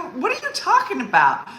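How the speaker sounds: baffled, demanding; exasperated. Retold or imitated. Exasperated retort.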